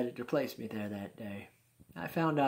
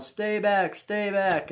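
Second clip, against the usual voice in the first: loud, raised-pitch warning to the crowd; guards' urgent order; 8 semitones higher, 9 dB louder, much slower than usual. Retold or imitated. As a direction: imitate guardsmen shouting people back.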